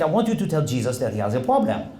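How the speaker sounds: imitated French priest; French accent. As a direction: repeat it in French-accented English. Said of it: "there's a priest visiting from France".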